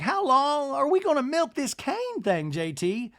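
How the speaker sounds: high, slow put-on complaint; Madison. Madison to J T about the cane thing, high mock complaint. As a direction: imitate her in a drawn-out high voice. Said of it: "Madison's like".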